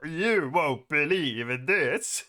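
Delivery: slow, lightly mocking Scandinavian accent; put-on Scandinavian accent. Streamer echoing a scuffed game voice, put-on Scandinavian accent.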